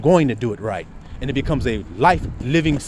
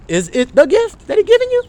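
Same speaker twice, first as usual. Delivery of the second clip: high calling out; 7 dB louder than usual. Real, not acted. Preacher calls to congregation.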